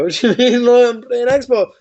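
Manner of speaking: high, slowed protest; can't believe he's asked; incredulous. Retold or imitated. Retold protest.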